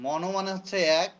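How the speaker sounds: ordinary delivery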